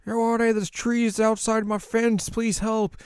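cartoonish villager asking for help; pleading lady. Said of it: "this lady's like".

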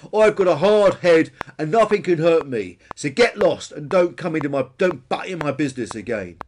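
loud boast falling into a warning; see off the meddler, loudly; belligerent bonehead Peter. A narrator's reading. Peter sends Billy away.

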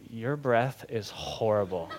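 low, quiet, slow verdict; deadpan complaint. Retold or imitated. Wife to husband in bed, deadpan complaint. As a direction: say it slowly and softly, deadpan.

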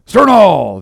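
high, loud drawn-out command; shouted order. A narrator's reading. Officer to oarsmen once harpoon is hurled, loud shout. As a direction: bellow it to the oarsmen.

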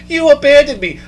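mock yell. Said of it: "he starts yelling at us".

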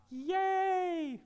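high, held little "yay"; playful, drawn-out high-pitched hooray; mock cheer. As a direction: squeal a long celebratory cheer on one high note. Mock cheer for passing the test.

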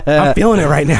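winded, breathing hard. Real, not acted. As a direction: say it while catching breath. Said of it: "I'm winded from these push-ups".

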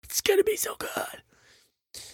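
breathy hushed hype, high and slow; mock whisper. Host to listeners, teasing next week's show in a whisper. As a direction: whisper it like a secret promise.

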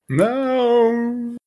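mock protest. Real, not acted. Reaction to player's choice.

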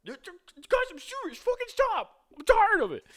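very high falsetto, begging them to stop; high falsetto plea from a bullied kid; picked-on kid yelling. Retold or imitated. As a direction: squeal it like the kid at the back of the bus who's had enough. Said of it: "someone in the back was going".

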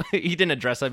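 normal talking voice

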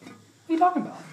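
high, baffled "What are you talking about?"; surprised. Retold or imitated. Answering Kelly, in baffled surprise. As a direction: lift it in disbelief. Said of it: "I was like".

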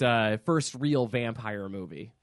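ordinary talk, nothing marked

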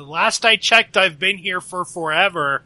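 high, very loud retort; shouted retort. Acted. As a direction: shout back across the street. Character answers the screaming women.

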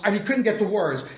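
ordinary talk, nothing marked

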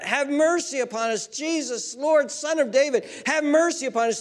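high, urgent crowd-piercing cry; retold beggars' scream. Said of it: "they begin to scream out".

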